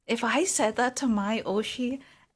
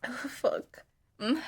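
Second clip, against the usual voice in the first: usual speaking voice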